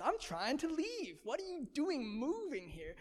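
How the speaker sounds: high, sing-song disbelief; incredulous. Retold or imitated. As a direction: imitate baffled locals in a high swooping voice. Voicing locals baffled by his moving.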